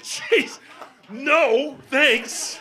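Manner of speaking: high, loud, appalled exclamation; emphatic refusal. Retold or imitated. Traveler turning down adopting Gunther, appalled. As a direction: shout the refusal in comic disbelief.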